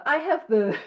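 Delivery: usual speaking voice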